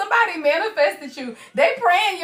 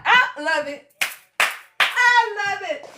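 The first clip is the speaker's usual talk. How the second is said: ordinary talk, nothing marked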